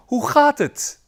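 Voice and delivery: Hoe gaat het with stress on gaat, pitched high; high bright model of Hoe gaat het; teacher demo. Shown as a demonstration. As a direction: model the question for the class, leaning on gaat. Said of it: "please repeat after me".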